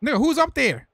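high, loud shout to teammates in the game; shouted call out. Real, not acted. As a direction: yell it up the pitch, demanding.

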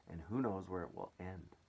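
ordinary delivery, nothing marked

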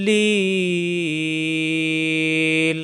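লিল held long on the vowel; three-alif elongation. Shown as a demonstration. Teacher demonstrates the madd, লিল drawn out. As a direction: stretch লিল out for three beats. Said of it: "তিন আলিফ টান হয়ে যাবে".

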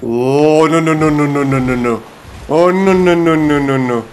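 long rapid run of no's; panicked. Real, not acted. Player in sudden danger, panicked string of no's. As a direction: let the no's tumble out, rising then sinking.

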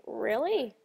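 understated surprised Really; mild disbelief. Retold or imitated. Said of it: "And I was thinking".

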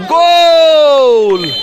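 long stretched high goal cry; drawn-out goal shout. Real, not acted. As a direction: hold the GOAL call as long as you can. Commentator screams a goal.

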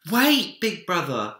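put-on high, loud child's cry; calling after. A narrator's reading. Minik calls to Big Bear turning away, high and loud. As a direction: call out before he leaves. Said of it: "he cried".

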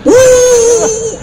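long, very high mock crying howl; exaggerated comic wail. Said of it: "nangislah di situ".

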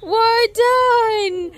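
high, slow, cooing voice. Real, not acted. Mother cooing at her toddler after the fall, high and slow.

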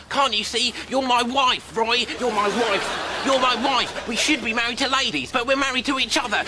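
high, loud, half-laughing lament; melodramatic outburst. Acted. Moss wailing at Roy like a despairing spouse. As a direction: wail it like a spurned lover.

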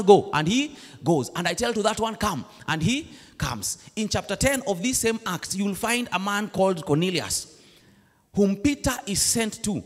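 usual speaking voice

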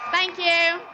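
loud, high shout to the crowd; shouted thanks. Real, not acted. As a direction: call it out over the crowd. Speech ends to cheering crowd.

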